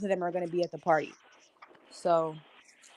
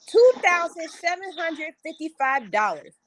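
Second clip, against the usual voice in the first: higher, slow, spelled-out amount; emphatic announcement; 9 semitones higher, slower than usual. Real, not acted. Host revealing the bank balance on the show, emphatic and slow. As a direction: land each number like a reveal.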